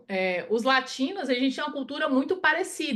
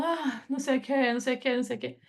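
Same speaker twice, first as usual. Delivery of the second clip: softer, listless droning with no energy; a sleepy, lifeless lecture voice; boring monotone; 3 dB quieter, faster than usual. Shown as a demonstration. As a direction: drone on like a dull speaker nobody listens to. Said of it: "vocês vai todo mundo dormir".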